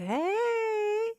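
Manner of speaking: high, drawn-out hey; a long sing-out greeting in a put-on high voice; Alicia's funny voice. Imitating Alicia greeting a man at his car, funny high voice. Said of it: "funny voice, like, where she'd be like".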